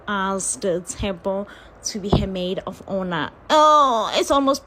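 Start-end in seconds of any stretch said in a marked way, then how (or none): 3.5-4.6 emotional exclaim. Real, not acted. Moved by maid of honor ask.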